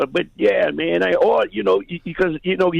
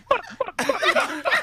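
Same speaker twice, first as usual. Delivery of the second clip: high squeaky cluck cluck; chicken clucking; 13 semitones higher, 5 dB quieter than usual. Retold or imitated. Caller teasing Ricky as a chicken, high mocking clucks. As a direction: cluck like a scared hen, teasing.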